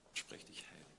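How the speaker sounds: soft whisper, almost no voice; whispered promise. Acted. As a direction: breathe the words like a secret blessing. God's promise, whispered intimately.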